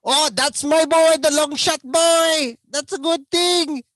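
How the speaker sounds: put-on high, loud Filipino-accented praise; imitating Filipino dad's delighted praise; Filipino father. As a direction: cheer your boy in a high Filipino accent. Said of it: "but my dad is at the table".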